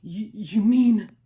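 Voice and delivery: stammering in slow, loud amazement; stunned, catching the breath; gasped. Acted. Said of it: "Horton gasped".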